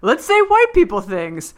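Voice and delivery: high, loud goofy list of exclamations; imitating Jason. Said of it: "He goes".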